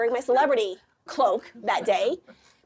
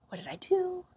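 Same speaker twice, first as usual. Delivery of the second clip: bewildered whisper; 9 semitones higher, 5 dB quieter than usual. Retold or imitated. Inner bewildered question.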